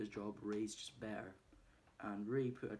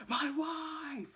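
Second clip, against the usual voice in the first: a much higher, louder "My wife!"; retold yell; 8 dB louder than usual. Mickey yelling at Matthew about his wife, loud yell. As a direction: yell it in sudden fury. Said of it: "And he just yells at him".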